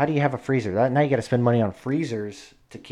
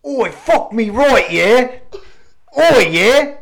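high, loud, slow mock Aussie; hammed-up bad Australian accent, high and loud; terrible Australian accent; 11 semitones higher, 11 dB louder, much slower than usual. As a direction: bellow it like a cartoon Aussie bloke. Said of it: "it's my terrible Australian accent".

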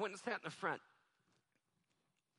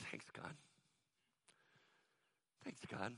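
hushed, private aside to God; inner thought; 6 semitones lower, 12 dB quieter than usual. Retold or imitated. Preacher silently thanking God as the boy speaks, hushed. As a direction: say it softly, as if only thinking it. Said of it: "in my mind, I'm just sitting there".

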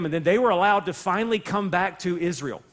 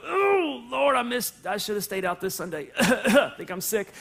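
mock dread. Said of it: "if you felt something go".

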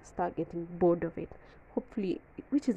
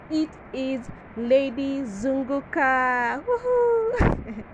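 excited; 4 semitones higher, 3 dB louder, much slower than usual. Real, not acted. Proudly announcing channel name.

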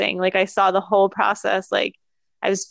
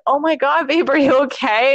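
higher-pitched mock concern; lightly voiced worried boyfriend; imitating freaked-out partner; 5 semitones higher than usual. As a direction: play a mildly panicked partner checking in. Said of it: "like, a little freaked out".

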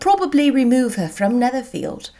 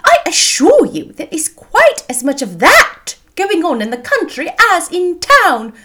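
high, loud indignant insistence; offended mother; 9 semitones higher, 6 dB louder than usual. A narrator's reading. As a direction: defend your home as an affronted matron. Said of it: "offended by his manner".

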